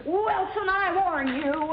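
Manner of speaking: slow, warning growl of a pretend dad; mock stern father. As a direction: threaten him slowly as the father. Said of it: "I'll pretend I'm Dad".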